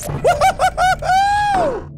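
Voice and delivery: high, slow whooping cheer. Real, not acted. Riding the falling dominoes, a high, slow whooping cheer. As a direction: hoot it out like a thrill ride.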